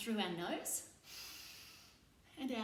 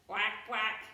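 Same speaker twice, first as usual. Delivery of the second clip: duck quacks. Shown as a demonstration. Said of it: "I'm gonna be a duck".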